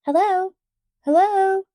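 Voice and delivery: high, loud call into an empty store. A narrator's reading. Felicity calling into the dark store, loud. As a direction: call out to find someone. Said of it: "She called out".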